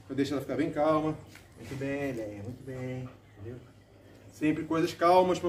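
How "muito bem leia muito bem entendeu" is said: soft calm praise; quiet soothing praise for a dog; talking to a dog. Real, not acted.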